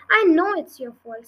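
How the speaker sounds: mildly narrated furious accusation; furiously. A narrator's reading. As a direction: snap back with real anger. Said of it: "said Bad Girl furiously".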